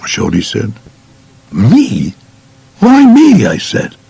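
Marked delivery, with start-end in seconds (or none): 1.5-3.5 shocked disbelief. Retold or imitated. Shocked at being the target.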